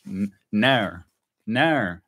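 Australian "no" with a bent, drawn vowel; Aussie accent. Shown as a demonstration. Explaining how Australians say no, Aussie accent. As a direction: say "no" like an Aussie, closer to "naur". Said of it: "interesting thing about the Aussie accent".

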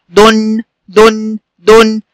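short u in دن, then hum the n; damma tanween. Shown as a demonstration. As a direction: say دن as "dun" with a held n. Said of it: "صوت نون ساكنة بعد الدال المضمومة". (نطق دن "دُنْ" بنون ساكنة)

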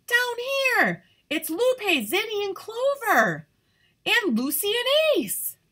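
high, loud call from far above. Acted. Tall sunflower calling down to her friends, high and loud. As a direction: shout down to the friends below.

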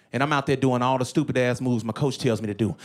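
usual speaking voice

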